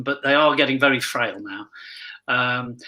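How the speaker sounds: usual speaking voice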